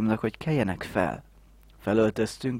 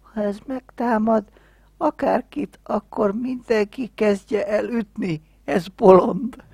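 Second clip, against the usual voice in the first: high-pitched voice; 12 semitones higher, 6 dB louder than usual. Retold or imitated. Voicing friend's warning about Mihály.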